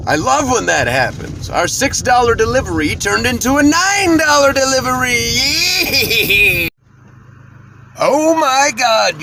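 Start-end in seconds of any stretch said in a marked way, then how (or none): 1.5-9.2 excited. Real, not acted. Thrilled about bigger pay.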